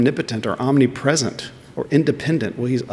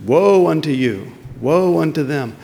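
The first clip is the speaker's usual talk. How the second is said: raised, higher prophet's proclamation of doom; prophetic pronouncement; 4 semitones higher than usual. Retold or imitated. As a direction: declare woe like an Old Testament prophet. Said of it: "This is what usually prophets would pronounce".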